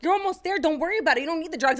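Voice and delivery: high, coaxing imitation of a nurse; reassuring nurse. Nurse telling labouring mother to go natural, coaxing.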